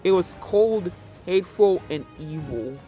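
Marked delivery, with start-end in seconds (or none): none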